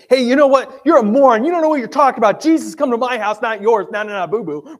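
put-on high, loud, childish comeback; hammed-up indignant gloating at the critics; mock angry taunt. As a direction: play a playground retort, loud and high-pitched. Imagined retort to the grumbling crowd.

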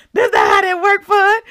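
high, loud amused repeat; mock outburst. The Lord correcting her prayer, high loud mock rebuke. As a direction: shout it high, fresh from laughing.

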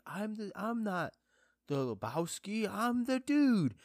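hammed-up film line with a loud stretched finish; the Dude. Fan throwing in a Big Lebowski quote, hammed-up impression. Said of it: "like, Big Lebowski's so great".

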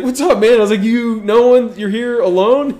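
teasing a guy alone at a party; mock chummy greeting. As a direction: hail him like an old pal. Retold greeting to lone guy.

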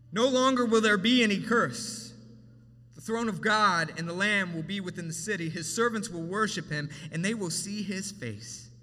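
loud, soaring scripture proclamation; preaching at full force. Acted. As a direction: lift the verses up to the congregation. Preaching the Revelation verses.

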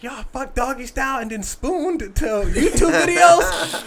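high, drawn-out incredulous retelling; mock outraged girlfriend. Retelling a girlfriend's outburst to co-hosts, high mock outrage. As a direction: act out her outrage, on the edge of laughing. Acting out angry girlfriend, cracking up.

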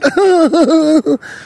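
high run of laughter. Real, not acted. Storyteller amused by his chariot joke, high laughing. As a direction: crack up high at your own joke.

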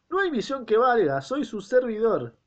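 high, loud, slowing peasant defiance; defiant peasant husband. Acted. Lucas blocking the doctor from his wife, high and loud. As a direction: plant your feet and refuse him, loud.

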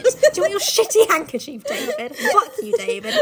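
high, loud mock tirade with a laugh; mock angry rant. Yelling at David about the handkerchief, mock angry. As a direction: rant at him, half laughing.

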